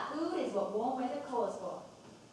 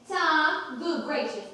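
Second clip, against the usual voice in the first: mock scolding; 5 semitones higher, 8 dB louder, much slower than usual. Mother quotes herself scolding son.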